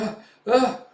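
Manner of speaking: high, loud wordless stutter; horrified stammer. Retold or imitated. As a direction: splutter in fright. Stammering in horror.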